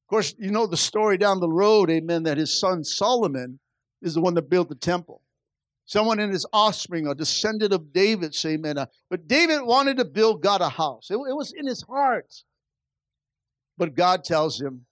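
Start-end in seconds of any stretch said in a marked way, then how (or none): none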